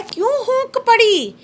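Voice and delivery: slow, drawn-out recital of a poem line, pitch up; poem recitation. Shown as a demonstration. Teacher reads the poem line to students, slow recital. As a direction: recite it like verse to the class. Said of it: "कवि कहता है".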